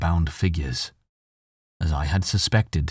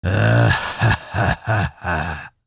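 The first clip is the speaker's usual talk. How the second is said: slow monster chuckle, loud at first then falling; throaty evil "ah ha ha" tailing off; throaty, garbled chuckle; 3 semitones higher, 3 dB louder, much slower than usual. A narrator's reading. Monster laughs at its captive, throaty garbled chuckle. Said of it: "A throaty, garbled chuckle rang".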